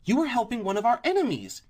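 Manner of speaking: high, loud accusing outburst; too loud. A narrator's reading. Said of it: "She had grown a bit too loud".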